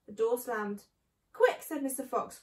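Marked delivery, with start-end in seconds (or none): 1.3-1.6 sharp, high, loud urgent cry; urgent call. Acted. Mr Fox urging the others to flee, sharp high call. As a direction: hurry everyone at once.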